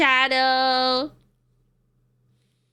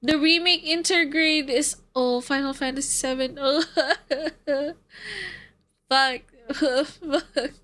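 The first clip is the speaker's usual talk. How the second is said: usual speaking voice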